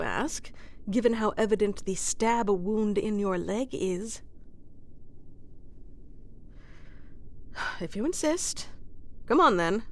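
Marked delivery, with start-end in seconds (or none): 4.3-7.8 long soft sigh of surrender; a quiet giving-up sigh; resigned sigh. Acted. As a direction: sigh and give in. Giving in to listener.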